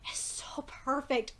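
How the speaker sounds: hushed, breathless whisper; awed whisper. Real, not acted. Reviewer whispering in awe at the animation. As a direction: whisper it like it's sacred.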